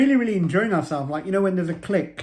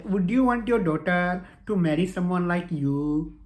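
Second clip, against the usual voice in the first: ordinary delivery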